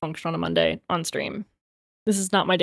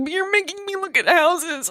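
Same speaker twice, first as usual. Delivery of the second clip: retold crying; 9 semitones higher than usual. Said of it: "looking at my husband and crying".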